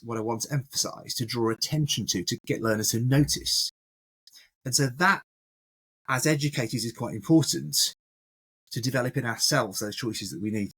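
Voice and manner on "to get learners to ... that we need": normal talking voice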